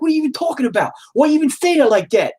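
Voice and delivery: high, indignant New York Italian voice; Italian-American wife. Retold or imitated. Wife mad at her teasing husband, mock Italian-American accent. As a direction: imitate an Italian-American wife's exasperated comeback. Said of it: "she starts becoming more Italian".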